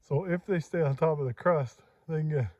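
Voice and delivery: ordinary talk, nothing marked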